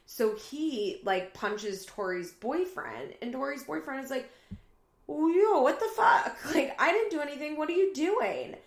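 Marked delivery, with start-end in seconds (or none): 5.1-8.6 high, loud mock protest; outraged disbelief. Boyfriend who just got punched, imitated shocked yelling. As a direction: act out startled indignation, rushed in the middle.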